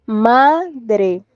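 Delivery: "Madre" with a long, stressed MA; "Madre" as a careful demo, MA prominent; stress on penultimate syllable. Shown as a demonstration. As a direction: stretch the first syllable of "Madre". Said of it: "el acento está en la penúltima sílaba".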